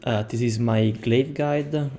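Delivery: ordinary delivery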